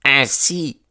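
high-pitched, indignant opening; furious. A narrator's reading. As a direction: throw it out as an angry challenge. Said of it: "dit-il en lançant un regard furieux".

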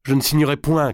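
high, defiant cry; cried out. A narrator's reading. Marguerite refusing to sign as she struggles, crying out. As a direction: cry out the refusal, pitched right up. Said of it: "cria-t-elle".

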